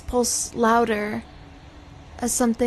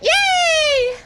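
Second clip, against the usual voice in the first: squeaky cheer; high squeal; 5 dB louder than usual. Real, not acted. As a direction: yelp it high.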